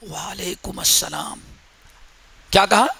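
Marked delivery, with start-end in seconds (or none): 0.0-1.4 very soft, low reply so no one hears; hushed reply. Retold or imitated. Companion answering the Prophet's greeting in a near whisper. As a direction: answer under the breath. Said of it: "صحابی نے چپکے سے آ کے کہا".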